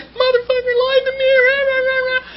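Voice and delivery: high, crying outburst of betrayal; retold tearful rant. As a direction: wail it out like a betrayed friend ranting to her sponsor. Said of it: "I was like".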